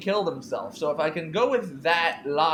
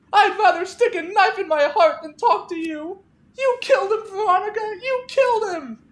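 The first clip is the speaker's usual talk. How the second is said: much higher, much louder accusing scream with a cry; Clarissa screaming; 8 dB louder than usual. Acted. Grieving Clarissa accuses Veronica through the locked door, screaming. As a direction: hurl it at her like she murdered him.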